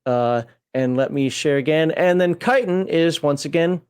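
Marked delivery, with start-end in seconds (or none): 2.4-2.9 chitin said KITE-in; K pronunciation. Shown as a demonstration. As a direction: open chitin with a hard K and long i. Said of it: "See the K there".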